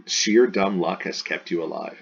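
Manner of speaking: usual speaking voice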